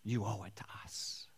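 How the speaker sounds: whispered demand; entitled workers whisper their demand. Acted. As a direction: hiss the claim.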